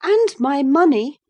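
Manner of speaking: high, loud retort; defiant girl. A narrator's reading. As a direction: fling it back at him. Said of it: "returning his angry glare".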